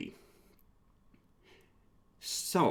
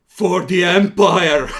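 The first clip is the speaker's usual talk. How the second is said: slow, loud slimy alien war cry; deep slug voice; 6 semitones higher, 17 dB louder, slower than usual. Acted. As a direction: bellow it like a giant slug. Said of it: "in a deep slug voice".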